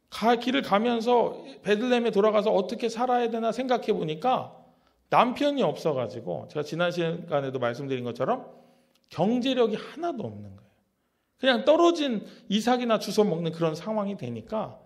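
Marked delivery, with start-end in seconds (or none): none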